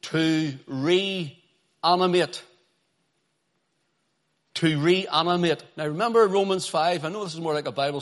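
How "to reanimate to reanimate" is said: drawn-out "reanimate"; slow emphasis. Shown as a demonstration. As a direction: stretch the word out. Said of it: "And it means".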